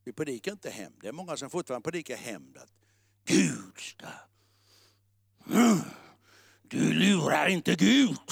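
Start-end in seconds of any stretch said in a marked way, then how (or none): 3.2-8.2 hushed, ominous mock warning; lightly voiced menacing preacher; mock vengeance preacher. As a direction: mimic a threatening hellfire preacher.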